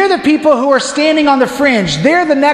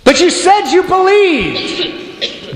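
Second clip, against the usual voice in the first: disappointed plea; 8 semitones higher, much slower than usual. Retold or imitated. Blondin disappointed, slow.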